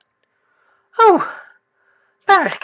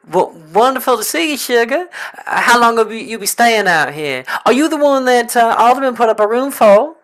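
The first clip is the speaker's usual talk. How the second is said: lightly higher Southern belle hostess; 6 semitones higher than usual. Acted. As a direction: welcome the guest sweetly, Southern style. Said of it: "southern drawl".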